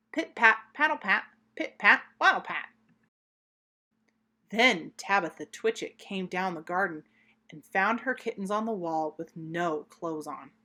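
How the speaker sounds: ordinary delivery, nothing marked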